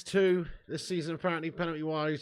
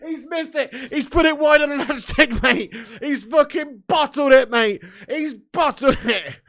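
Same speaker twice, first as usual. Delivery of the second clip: high, loud, laughing gloat; crowing over the miss, barely holding it together; gleeful gloating; 6 semitones higher, 8 dB louder than usual. Real, not acted. Fan gloating to chat about the bottled penalty, excited. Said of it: "I properly almost choked then".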